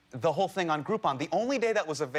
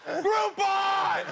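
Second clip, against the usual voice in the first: much higher, triumphant shout of the brand name; proud mock shout; 14 semitones higher, 3 dB louder than usual. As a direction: yell Groupon proudly. Proud reveal in story.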